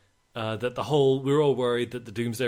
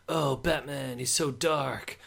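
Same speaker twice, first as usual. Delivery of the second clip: high, drawn-out mocking gush; Zack Snyder; 4 semitones higher, 3 dB louder than usual. Podcaster parodying the director's take on dark heroes, mock awe. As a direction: exaggerate it slowly, pitched up, in parody. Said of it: "Zack Snyder's not gonna be like".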